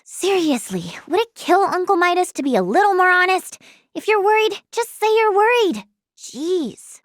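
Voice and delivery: exasperated rise, then a deflated jeez. Acted. Niece grumbling about her uncle's dishonesty, exasperated. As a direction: complain with rising exasperation.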